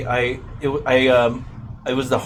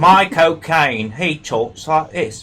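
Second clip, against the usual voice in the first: a hammed-up Cockney, slow and higher; bad Michael Caine; 4 semitones higher, 4 dB louder, slower than usual. Doing Michael Caine for the host, a bad slow Cockney. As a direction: butcher Michael Caine's accent on purpose. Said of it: "the worst Michael Caine I've ever heard".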